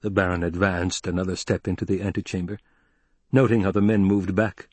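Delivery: usual speaking voice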